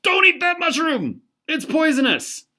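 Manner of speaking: high, loud warning shout; alarmed shout. Acted. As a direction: shout to stop your buddy from eating the mushroom. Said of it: "Your friend reaches out and says".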